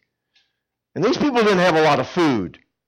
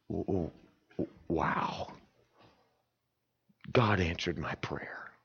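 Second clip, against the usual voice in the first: awed wow; 8 semitones lower, 14 dB quieter, slower than usual. Acted. Preacher acts awed prayer answered.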